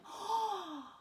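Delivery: high, light gasp of alarm; a quick acted gasp; mock gasp. Camp waking to find the spear gone, a mock high gasp.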